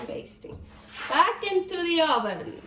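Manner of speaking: usual speaking voice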